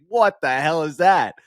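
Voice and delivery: slow, mocking what-is-that; drawn-out incredulous jeer at the photo; mocking disbelief. Everyone reacting to the jersey photo, slow mocking disbelief. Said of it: "Everyone's like".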